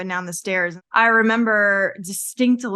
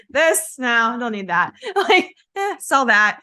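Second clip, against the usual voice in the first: laughing while sorting; 4 semitones higher than usual. Retold or imitated. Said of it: "it's literally just like".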